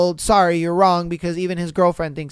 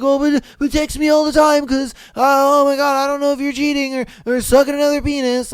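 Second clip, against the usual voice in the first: higher, louder mocking yelp of a paranoid boyfriend; mock clingy boyfriend; 6 semitones higher, 4 dB louder than usual. Mocking a jealous boyfriend for the viewers, higher and louder. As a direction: ham up the jealous boyfriend begging for texts. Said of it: "he wants to be like".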